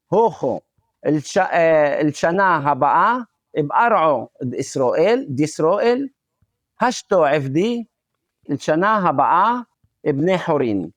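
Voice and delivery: brisk Aramaic reading with o endings; Western Aramaic accent. Shown as a demonstration. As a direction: read out in a Galilean Aramaic accent, phrase by phrase. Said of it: "in my accent".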